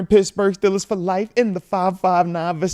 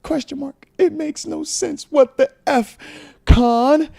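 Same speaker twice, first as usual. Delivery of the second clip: exasperated fan; 9 semitones higher than usual. Retold or imitated. Reading a fuming fan's super chat aloud, exasperated. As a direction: read the fan's rant with growing outrage. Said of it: "He says".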